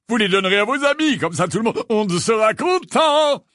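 high, loud, gushing voice with chuckles; exuberant addled celebrity, self-satisfied. Acted. Lockhart pushes autographs on his former pupils, exuberant. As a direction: brag cheerfully, bursting with self-delight.

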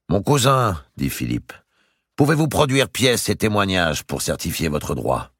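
lightly voiced royal question; king's question. A narrator's reading. The king asking Robert for proof, lightly voiced. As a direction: ask with measured authority.

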